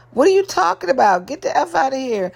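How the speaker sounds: fast, high incredulous snap-back; mock outraged retort. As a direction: fire it back at him in disbelief. Wife snaps back at husband.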